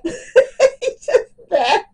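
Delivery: high, loud, slow laughing repeat; laughing words. Real, not acted. Telling viewers about tears that won't stop, laughing. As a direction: break up laughing as you say it.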